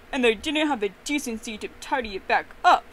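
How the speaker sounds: loud, indignant hiss. A narrator's reading. Papa Bear seeing the untidy bed, hissing angrily. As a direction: hiss it out, loudly offended. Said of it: "Papa Bear hissed".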